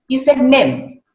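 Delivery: NEM with a short e, no glide; mispronounced vowel. Shown as a demonstration. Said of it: "That again is wrong or incorrect pronunciation".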